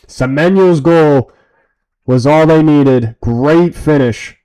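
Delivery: emphatic slow proclamation; loud and slow. Real, not acted. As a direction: belt it out slowly. Hyped post-match verdict.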